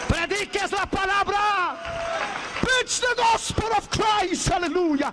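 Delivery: high, shouted call to preach the gospel; rally the crowd with a ringing cry; fervent preaching at full volume; fiery preaching. Acted.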